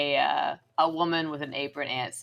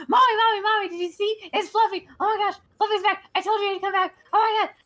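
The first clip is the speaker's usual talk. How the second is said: put-on very high, fast child's voice, overjoyed; gushing little girl; 4 dB louder, faster than usual. Little girl hugging her lost cat, squealing high and fast with joy. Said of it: "he's like".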